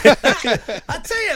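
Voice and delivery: laughing and high with glee; slow, gleeful, laughing delivery; elated laughing. Real, not acted. Still elated after the win.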